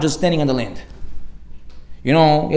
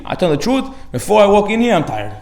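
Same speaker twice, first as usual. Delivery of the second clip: normal talking voice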